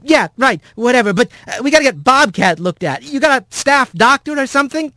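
high, brisk, dismissive rat voice; high-pitched urgency; impatient Rat. A narrator's reading. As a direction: brush off the formalities and push for help. Rat brushes off Thax, asks for help.

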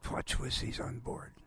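hushed, slurred imitation of a warning. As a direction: pass on a message so it can't be made out. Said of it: "tapped me on the back".

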